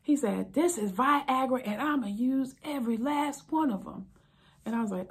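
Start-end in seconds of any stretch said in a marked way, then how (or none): 0.5-4.1 defiant husband. Retold or imitated. Husband defiant about pills.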